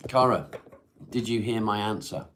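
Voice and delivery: loud call across the house. Real, not acted. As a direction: call her from another room. Calling out to the other room.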